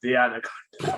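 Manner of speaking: lightly put-on film villain voice; a hint of a hammy snake hunter; Jon Voight. Co-host joins in on the monologue, light impression. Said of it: "you're trying to do his monologue".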